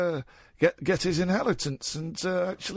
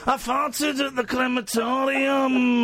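slow, high Scottish comic wail; 6 semitones higher, slower than usual. Acted. Host as comedian confessing on stage, high drawn-out Scottish impression. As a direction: stretch the words out, raucous Scottish raconteur. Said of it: "[name] back again".

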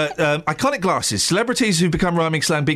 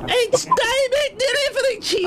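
very high, slow, laughing voice; hammed-up falsetto impression; Alan Whicker; slower than usual. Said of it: "We'll have that".

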